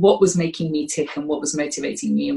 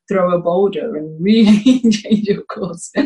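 laughing through the words, slower; 2 semitones higher, 6 dB louder, slower than usual. Real, not acted. Guest laughs describing life's boulder, laughing. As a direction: chuckle out the big finish.

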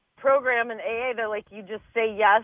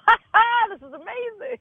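giddy delight; 11 semitones higher than usual. Acted. Comic imagining the audience finally laughs, giddy delight. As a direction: act out tickled amazement, voice way up.

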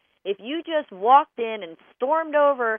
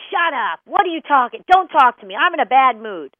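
snapping, louder and louder; a husband back from work, snarling at his spouse; retold angry husband; 8 dB louder than usual. Husband home in a bad mood, shouting at his wife. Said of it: "and he says".

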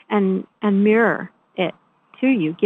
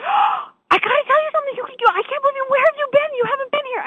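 much higher, shouting, overjoyed reunion; excited janitor; faster than usual. Retold or imitated. The shelter janitor greeting her after weeks, shouting with joy. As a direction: burst out with delight at seeing her.

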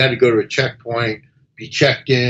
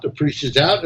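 ordinary talk, nothing marked

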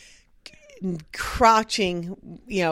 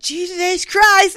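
higher, louder, slow shocked gasp of a curse; retelling a father's stunned reaction, slow and loud; shocked exclamation; 9 semitones higher, 7 dB louder, much slower than usual. Retold or imitated. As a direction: blurt it out, stunned and drawn out. Retold dad's shocked outburst.

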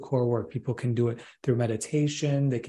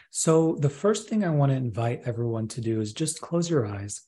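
right in your ear. Acted. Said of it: "I want to take you up on that offer to do a process".

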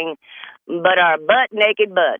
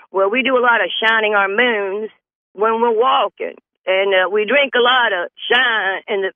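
put-on country boondocks accent; 5 semitones higher than usual. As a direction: ham up a hillbilly moonshine talker. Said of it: "this is how she talks now".

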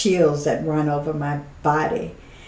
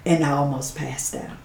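usual speaking voice

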